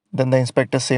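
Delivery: ordinary talk, nothing marked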